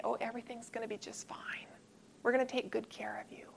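fast whispered pat-on-hand reassurance; quick soothing brush-off. Retold or imitated. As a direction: rattle off soothing words without listening. Reflexive reassurance to scared patient.

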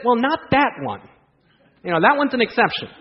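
squirm out of it; hammed-up sheepish hedge; backpedaling excuse. Man backpedals on his claim.

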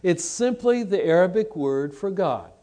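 normal talking voice

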